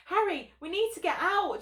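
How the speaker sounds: a hint of a panicked shout; shouted breathlessly. A narrator's reading. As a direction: call out urgently while short of breath. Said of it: "Jenny shouted breathlessly".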